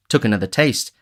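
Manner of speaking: plain reading voice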